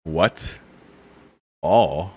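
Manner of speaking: normal talking voice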